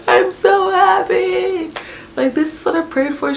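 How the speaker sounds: high, stretched happy wail, easing off; mock bawling. Overjoyed mother-to-be to the caller, mock bawling. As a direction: imitate blubbering with joy. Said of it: "started bawling".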